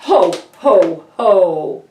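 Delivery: loud, booming jolly ho ho ho; jolly Santa laugh. A narrator's reading. Santa bursts out to the elves in a jolly voice. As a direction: boom it out like a merry Santa. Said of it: "Santa exclaimed in his jolly voice".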